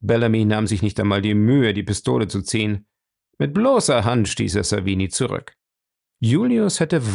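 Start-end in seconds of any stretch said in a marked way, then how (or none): none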